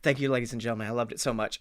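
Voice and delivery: low, quiet acted thank-you; soft sign-off. Speaker acts ending a TED talk, quiet mock sign-off.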